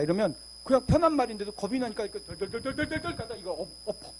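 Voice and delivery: usual speaking voice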